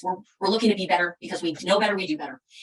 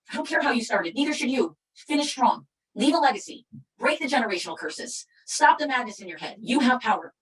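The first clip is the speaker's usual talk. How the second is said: motivational preaching; 4 semitones higher than usual. Acted. Speaker rallying her followers to break curses, preaching. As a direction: fire up the crowd with every short line.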